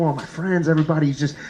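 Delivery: ordinary delivery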